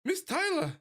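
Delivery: put-on high voice with a French accent. Miss Tyler thanking the lawyers, in a French accent. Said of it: "giving her a French accent".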